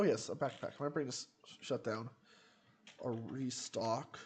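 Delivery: ordinary delivery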